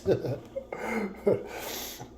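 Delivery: high, trailing chuckle; real rueful laugh. Real, not acted. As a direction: chuckle at your own remark. Laughs about more suffering.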